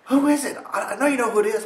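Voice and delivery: very high loud accusing voice; accusing friend. Retold or imitated. Friend phoning to accuse him of being the gnome, high loud. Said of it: "And she's like".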